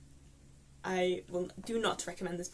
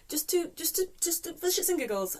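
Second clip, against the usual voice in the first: exasperated rant; 8 semitones higher, 4 dB louder than usual. Real, not acted. Ranting about a pointless plot.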